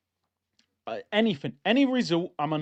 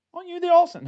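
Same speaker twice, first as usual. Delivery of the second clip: high, louder acted stranger; excited recognition; 11 semitones higher, 5 dB louder, much faster than usual. Gym stranger asks if he's the Arsenal guy, high excited recognition. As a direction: pipe up high, as if spotting someone known.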